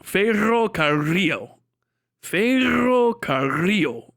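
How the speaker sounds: Ferrocarril slowly, with both Rs trilled; slow Ferrocarril with long effortful trills; rolled Rs. Shown as a demonstration. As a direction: roll each double R in Ferrocarril, drawn out. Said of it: "I'm trying to get those rolls".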